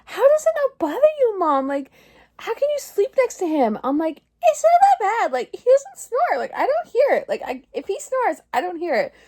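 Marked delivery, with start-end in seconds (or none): 0.0-3.8 put-on higher kids asking mom; quick, high childish question; kids' voice. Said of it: "they're always like". 4.4-9.1 high mock defense. Retelling own defense of husband.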